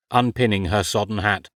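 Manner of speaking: usual speaking voice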